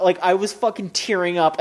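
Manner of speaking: usual speaking voice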